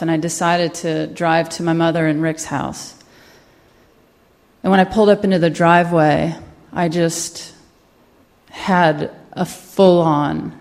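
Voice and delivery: usual speaking voice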